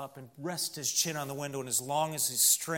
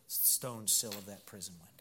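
whisper; 6 semitones lower, 8 dB quieter than usual. A narrator's reading. Story climax dropped to whisper.

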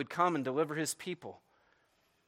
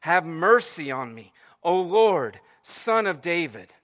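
louder, drawn-out plea; desperate cry; 3 semitones higher, 8 dB louder, slower than usual. Acted. Woman begging Jesus for her daughter, crying out desperately. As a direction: call out imploringly, voice raised. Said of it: "she cries out to him desperately".

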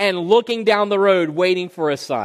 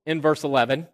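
normal talking voice

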